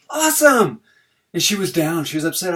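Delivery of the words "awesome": high, loud burst of praise; excited praise. Retold or imitated. Dad cheering his daughter after the test, high excited praise. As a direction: cheer her on with a big awesome.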